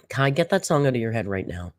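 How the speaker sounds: ordinary talk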